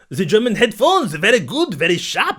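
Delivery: loud, high cartoon German; mock German accent. Co-host demonstrating a German headphone review, loud mock German. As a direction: push out a hammed-up German accent. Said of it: "how about the German".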